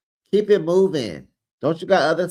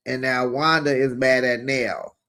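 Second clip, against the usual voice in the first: usual speaking voice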